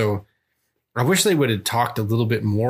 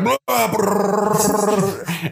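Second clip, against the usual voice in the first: mock gunfire; 10 semitones higher than usual. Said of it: "the Yakuza member is like".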